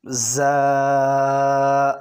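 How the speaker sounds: زا buzzed hard and firm. Shown as a demonstration. Teacher models a firm زا for the class. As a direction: press زا out firmly. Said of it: "یہ زا سختی سے پڑھا جائے گا".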